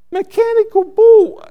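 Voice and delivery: very high, loud disbelief; incredulous squawk. Acted. Interpreter aghast at pastor's mechanical bull announcement, incredulous high yelp. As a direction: squeak it out in mock shock. His startled reaction to the announcement.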